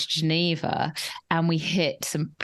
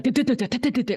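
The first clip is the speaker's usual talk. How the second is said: rattling du-du-du, a little higher; imitated turbulence rattle; 7 semitones higher, faster than usual. Imitating the plane juddering for a friend, rattling du-du. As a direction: bounce it like a shaking plane. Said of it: "The plane was going like".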